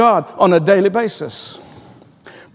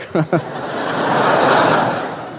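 laughing at his own story; 4 semitones lower, 3 dB quieter than usual. Real, not acted. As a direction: let out a real laugh after the punchline.

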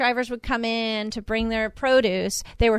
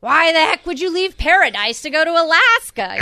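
loud, higher-pitched retelling of a comedian's punchline; mock incredulous; 6 semitones higher, 6 dB louder than usual. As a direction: imitate a comic's baffled outcry.

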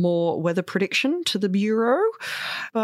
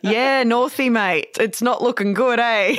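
satellites as Australian lads; thick Australian accent; 6 semitones higher than usual. Pretend. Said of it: "really thick Australian accents".

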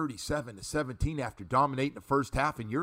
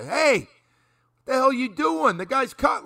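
high, loud mock scolding from the sideline; screaming coach; 10 semitones higher, 7 dB louder than usual. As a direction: yell it like an exasperated high school coach. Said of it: "they'd be screaming at you".

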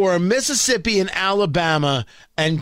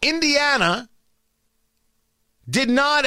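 ordinary talk

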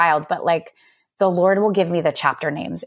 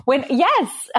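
high, breaking into laughter; laughing; 8 semitones higher than usual. Real, not acted. Guest laughing back at the host's age joke. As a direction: laugh along at the tease.

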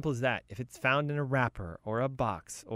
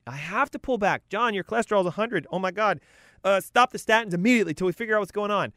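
higher-pitched doctor amazed by the results; urgent mock-physician outburst, voice raised in pitch; alarmed doctor; 7 semitones higher, 4 dB louder than usual. Doctor reading John's cholesterol result, high, urgent astonishment. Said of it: "your doctor goes".

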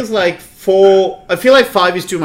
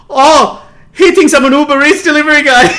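shocked realization; 11 semitones higher, 6 dB louder than usual. Retold or imitated. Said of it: "I'm like".

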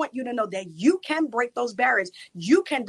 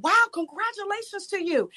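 delighted congratulations; 11 semitones higher than usual. Retold or imitated. Social worker congratulates her.

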